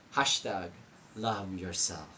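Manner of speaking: low, soft mocking caption read; dry teasing hashtag, voice dropped low. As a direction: drop the voice to tease the hashtag. Mocking social media caption again.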